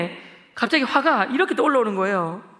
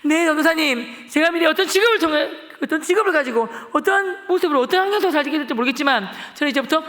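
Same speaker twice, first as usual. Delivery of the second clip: tearful vow; 6 semitones higher, 5 dB louder than usual. Retold or imitated. Boy answering the pastor with a tearful, loud vow. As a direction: vow your life through tears. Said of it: "제가 펑펑 울면서".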